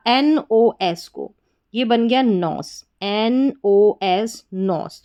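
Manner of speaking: slow spelled-out syllable; letter by letter. Shown as a demonstration. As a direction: spell each letter slowly then blend. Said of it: "तोड़ तोड़ कर पढ़ेंगे". (अक्षर-अक्षर धीरे बोलना)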